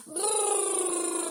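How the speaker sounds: loud high shivering brrr. Shown as a demonstration. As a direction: shiver out a big cold brrr. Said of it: "we're gonna do a big brrr sound".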